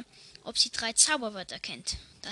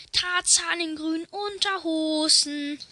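Kleinkind schreit; 5 semitones higher than usual. Acted.

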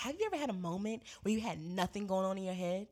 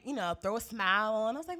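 ordinary talk, nothing marked

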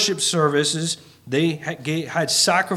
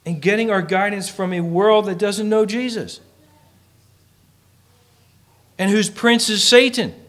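higher, louder, driving preacher's climax; a rising preacher's challenge to the congregation; preaching at full force; 6 semitones higher, 4 dB louder than usual. Acted. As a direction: build the rhetorical question to a peak.

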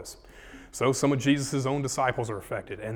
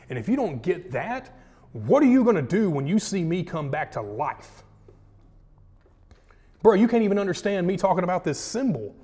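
building higher and more urgent, line by line; earnest pleading Jesus; 7 semitones higher, 4 dB louder than usual. Acted. Jesus urging departing disciples to stay and talk. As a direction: press harder and higher with each question.

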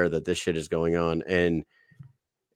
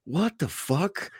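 high, slow astonished what; stunned disbelief; 8 semitones higher, slower than usual. Retold or imitated. Sergeant major seeing Condit in line, stunned. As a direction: drag it out in shock. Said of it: "He goes".

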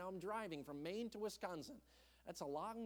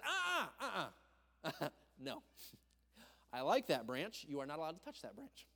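stubborn tree; 4 dB louder than usual. Pretend. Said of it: "and the tree says".

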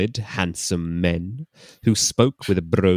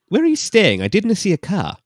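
Scottish man; 13 semitones higher, 6 dB louder than usual. Acted.

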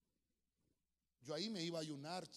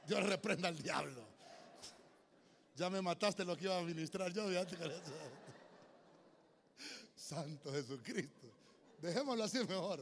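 mock exasperation; 5 dB louder than usual. Joking exasperation with congregant.

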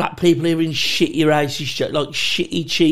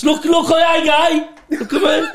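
very high, louder pleading, laughing; mock frantic plea; 13 semitones higher, 6 dB louder than usual. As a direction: beg the lads to look, wound up. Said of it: "to the lads".

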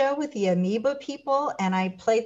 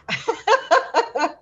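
high, bubbling giggle; real laugh; 10 semitones higher, 4 dB louder than usual. Real, not acted. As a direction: laugh at your own joke. Laughs at her own joke.

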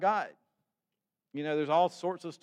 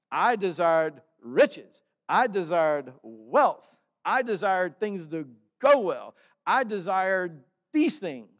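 emphatic confession; 8 dB louder, slower than usual. Acted. Voicing the psalmist's confession.

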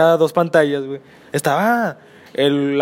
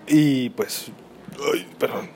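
drinking water; 5 dB quieter, slower than usual. Real, not acted. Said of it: "Perdón, es que estoy tomando agua".

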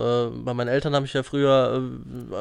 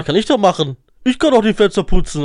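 louder, higher eager offer; eager insisting; 5 semitones higher, 5 dB louder than usual. Retold or imitated. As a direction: insist you can do it. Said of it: "Dann hab ich gesagt".

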